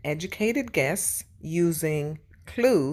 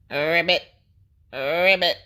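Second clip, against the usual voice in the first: frog croak; 6 dB louder than usual. A narrator's reading.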